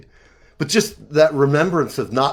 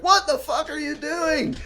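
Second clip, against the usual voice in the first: high mock yell of a startled father; angry dad; 13 semitones higher, 5 dB quieter than usual. Dad yelling at son in crashed van, high mock outburst. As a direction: play the dad bursting in. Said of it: "and was like".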